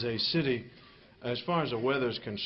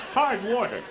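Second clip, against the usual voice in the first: amazed caveman; 8 semitones higher, 6 dB louder than usual. Acted. Caveman naming hail.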